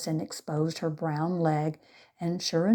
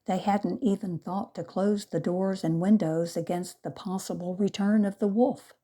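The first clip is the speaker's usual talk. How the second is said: ordinary delivery, nothing marked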